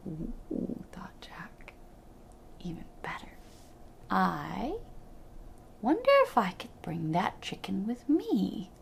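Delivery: put-on hushed whisper of a sneaky boy; whispered thought. A narrator's reading. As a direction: whisper it as a secret plan. Jack thinks secretly.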